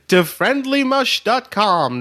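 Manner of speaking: drawn-out high plug; slow, clear plug. Shown as a demonstration. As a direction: spell it out slowly. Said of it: "That's friendly as in your friend".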